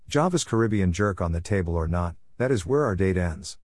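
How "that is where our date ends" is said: ordinary talk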